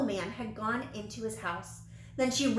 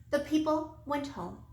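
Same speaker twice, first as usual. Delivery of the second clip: ordinary delivery, nothing marked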